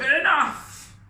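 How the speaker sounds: huge roaring shout; lion's roar. Acted. King Lion silencing the laughing animals, roaring shout. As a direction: roar to silence them all. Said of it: "But King Lion roared".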